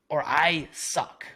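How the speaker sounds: slow punchline. Real, not acted. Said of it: "self-deprecating punchline".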